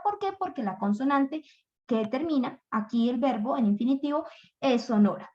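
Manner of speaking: ordinary delivery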